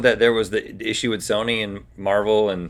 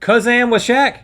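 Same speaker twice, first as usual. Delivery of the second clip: surprised; 11 semitones higher, 3 dB louder than usual. Real, not acted. Disbelief at Kazam fact.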